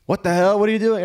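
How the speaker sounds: high, loud mock-panicked outcry; panicked crowd. Whole family reacts to a gun, acted panic. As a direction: play the alarmed relatives at full pitch.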